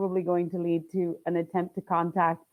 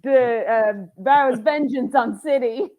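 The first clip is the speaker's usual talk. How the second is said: higher, louder mock newsreader; news headline; 6 semitones higher, 5 dB louder than usual. Co-writer voicing news cycle about giant woman, mock announcer. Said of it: "you've got the news cycle".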